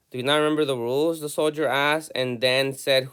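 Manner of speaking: "Do you not remember the rules?" rattled off fast; quick read-through. Shown as a demonstration. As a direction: rush through the line to reread it. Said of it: "let's see what else we got here".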